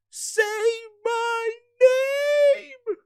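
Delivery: very high, slow crying beg; hammed-up wailing plea. Bootleg Joel's last plea to Chief, mock crying wail. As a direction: stretch out a fake sobbing wail.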